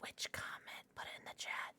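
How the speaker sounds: very soft, voiceless whisper; hushed, barely audible; whispered aside. Real, not acted. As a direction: whisper it off to the side. Aside during the stream.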